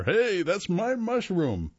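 high, drawn-out mock shout; joking yell. A narrator's reading. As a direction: call over in playful mock outrage. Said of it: "a man steps out and yells over".

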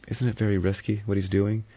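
worried for the man going up alone; anxious. A narrator's reading. Vera asking Philip about Blore, mildly anxious. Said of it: "Vera said anxiously".

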